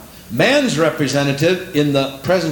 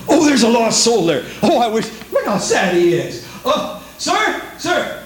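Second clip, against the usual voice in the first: mock pushy soul-winner; 7 semitones higher than usual.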